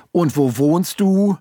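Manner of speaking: high, drawn-out exasperated demand; impatient, rude. A narrator's reading. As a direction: lose patience with the child, stretching each word. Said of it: "fragte ungeduldig in einem Ton, den man getrost als unhöflich". (ungeduldig und unhöflich, hoch und gedehnt)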